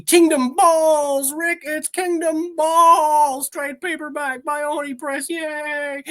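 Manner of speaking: high, slow, squeaky cartoon sidekick; excited cartoon voice. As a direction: yell it like a thrilled cartoon kid. Cartoon fan excitement, put-on voice.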